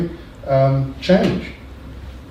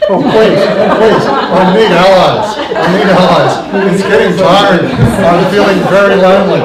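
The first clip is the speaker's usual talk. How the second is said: high, loud laughing appeal; laughing plea; 8 semitones higher, 12 dB louder than usual. Real, not acted. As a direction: laugh through a desperate plea for help.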